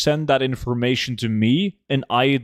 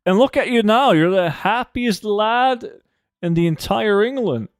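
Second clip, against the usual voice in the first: much higher, hammed-up happy British lad; mock cheerful Brit; 9 semitones higher than usual. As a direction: overact a chirpy British lad, pitched way up.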